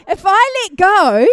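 rally the congregation to hold on tight; preaching with growing force; fervent preaching. Acted. Fireman's grip demo.

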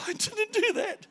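high, slowed panicked protest; frightened denial, pleading innocence; freaked out. Retold or imitated. Preacher reliving his panic at hearing the man died, pleading to God. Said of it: "And I freaked out".

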